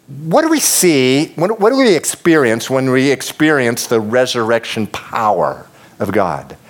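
normal talking voice

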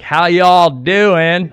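loud, drawn-out exaggerated Southern drawl; Southern accent. Brit imitating Americans with a greeting, exaggerated loud Southern accent. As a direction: holler a mock Southern greeting, stretching every word. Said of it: "what we would call Southern".

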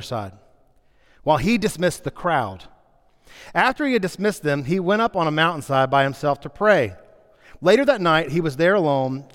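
reading the passage aloud to the congregation; scripture reading. Acted. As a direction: read it like a story for the church. Reads the Bible passage aloud.

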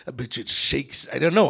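ordinary talk

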